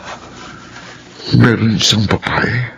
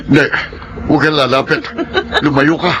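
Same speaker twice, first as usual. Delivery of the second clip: higher, frantic begging; panicked pleading; 8 semitones higher, faster than usual. Acted. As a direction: plead in terror. Man begs ghost to stay away. (nagmamakaawa sa takot)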